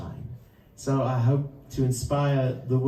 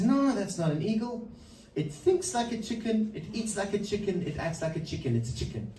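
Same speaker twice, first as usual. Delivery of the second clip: higher, matter-of-fact farmer settling down at the end; dismissive farmer; 9 semitones higher than usual. Retold or imitated. Farmer insists to his friend it is a chicken, higher and dismissive. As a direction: brush off the visitor as the sure farmer. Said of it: "He says".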